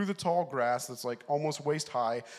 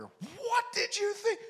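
high, slow, shouted mock mom; mom's yell; slower than usual. Mom shouting at the son inside the cabin, a high yell. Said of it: "I hear".

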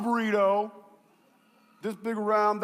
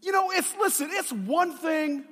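high, raised, exasperated protest; furious; 7 semitones higher, 4 dB louder than usual. Retold or imitated. Angry customer objecting to the shop's answer, furious protest. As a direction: re-enact outrage with a raised pitch. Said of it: "I was furious and I was angry".

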